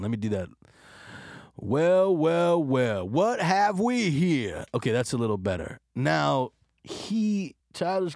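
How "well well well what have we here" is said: slow, smooth, louder swagger; Lando impression. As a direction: purr it like a suave smuggler. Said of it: "another impression".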